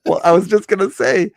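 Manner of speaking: high and loud through laughter; laughing. Real, not acted. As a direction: get it out while cracking up.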